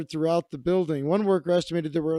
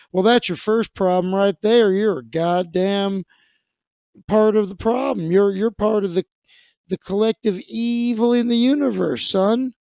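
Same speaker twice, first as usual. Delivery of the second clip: louder, building into an angry sermon; preachy scolding; 4 semitones higher, 5 dB louder than usual. Retold or imitated. Dave Ramsey voiced as scolding a caller, loud and preachy. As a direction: scold him like a fire-and-brimstone preacher.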